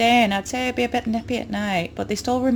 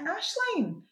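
high slow surprised question; mimicking a workmate, pitched up; coworker's question; much slower than usual. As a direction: ask it like a curious colleague, drawn out. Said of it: "she's like".

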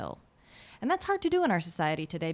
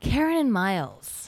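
smiling; 3 semitones higher than usual. Real, not acted. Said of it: "You smile when you say Karen and Miles".

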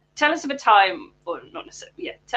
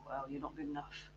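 very quiet, muted self-doubt; hushed inner critic; negative inner voice; 3 semitones lower, 20 dB quieter than usual. Acted. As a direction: murmur it like a nagging thought. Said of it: "Training that little voice".

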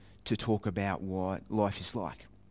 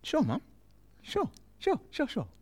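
put-on squeaky, far higher "sure, sure" of a kid promising; fake innocent. Boy promising mum he won't fire the ray gun, squeaky. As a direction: brush her off sweetly. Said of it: "And I go".

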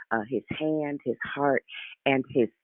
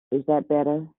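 muffled, like a covered mic; muffled bass only. Real, not acted. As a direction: talk through a covered headset mic. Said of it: "It just sounds like something is over the mic".

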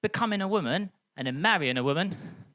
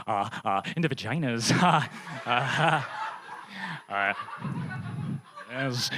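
low, halting Goldblum stammer; Jeff Goldblum impression; 7 semitones lower, 3 dB quieter than usual. Comic as Jeff Goldblum to the audience, low halting stammer. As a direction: stumble through it like Goldblum. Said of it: "ninth Jeff Goldblum impression".

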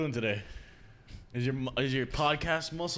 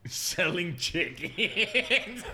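laughing; much slower than usual. Real, not acted. Laughing repeat of the prank.

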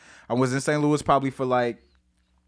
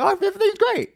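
high, sing-song fake cheer; falsely upbeat everything's great, way up high; fake cheerful; 4 dB louder, much faster than usual. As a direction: pretend all is fine, very high and bright. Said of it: "those prideful conversations".

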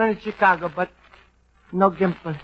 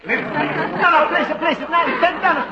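excited pleading; 8 semitones higher, slower than usual. Acted. Luigi flustered, excited pleading.

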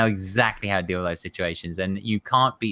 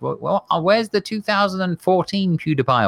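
whiny commenter; 8 semitones higher, 3 dB louder than usual. Retold or imitated. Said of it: "they kept saying things like".

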